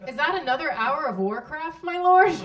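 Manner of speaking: mock the husband with a sugary jab; sarcastic. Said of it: "rife with sarcasm".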